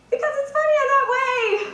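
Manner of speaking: high-pitched sitcom comedian impression; Jerry. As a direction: exclaim it high, imitating the star. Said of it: "And Jerry says".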